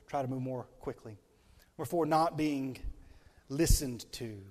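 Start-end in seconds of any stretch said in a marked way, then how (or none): none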